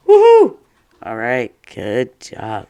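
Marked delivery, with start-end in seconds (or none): 0.1-0.6 high, loud woohoo; woohoo cheer. Pretend. Teacher leading a cheer for students. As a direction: let out a big celebratory woohoo. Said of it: "go ahead and go".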